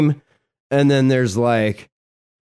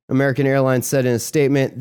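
usual speaking voice

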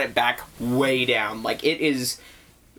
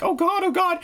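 high, panicky maid voice; frightened maid. Retold or imitated. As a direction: gasp it out high like the scared maid. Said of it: "the maid's like".

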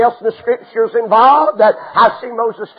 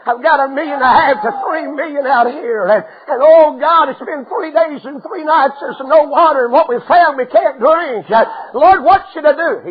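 higher, urgent pleading to God; desperate plea; 6 semitones higher than usual. Acted. Moses begging God in the desert, raised anxious plea. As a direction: cry out to the Lord in distress.

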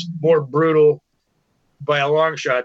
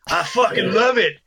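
higher-pitched, emphatic verdict; retold enthusiastic rave; 7 semitones higher than usual. Bandmate raving about the demo after a fake-out no, enthusiastic. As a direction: blurt out the mimicked rave.

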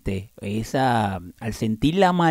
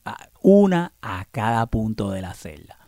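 whispering; 3 semitones lower than usual. Real, not acted. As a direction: breathe it out unvoiced. Aside while demonstrating.

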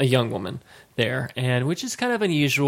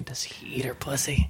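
unvoiced, hushed gossip; soft whispered speculation; whispered thought; 7 dB quieter than usual. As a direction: whisper it like a secret question. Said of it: "so the entire time we were thinking".

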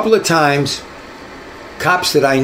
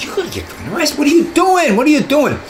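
alarmed partner; 13 semitones higher than usual. Retold or imitated. Partner pulling him out of fight.

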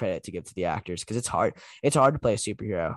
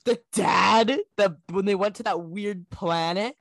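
mocking whine; 10 semitones higher, 4 dB louder, slower than usual. Real, not acted. Complaining about the movie.

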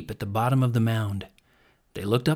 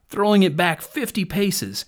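plain reading voice

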